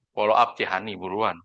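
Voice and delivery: ordinary talk, nothing marked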